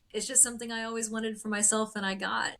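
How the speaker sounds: ordinary talk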